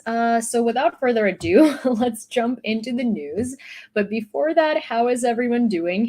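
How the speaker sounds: ordinary talk, nothing marked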